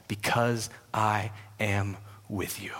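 slow, soft and low; slow tender assurance. Acted. God reassuring frightened Paul, slow and soft. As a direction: promise it quietly and slowly.

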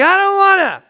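put-on sulky kid refusing; petulant whine back at God; whiny refusal.

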